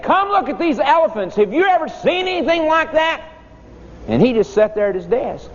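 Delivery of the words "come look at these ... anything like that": put-on high, eager children calling out; excited kids calling. Kids calling teacher to the window, high and excited. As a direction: call the teacher over, thrilled. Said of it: "we said".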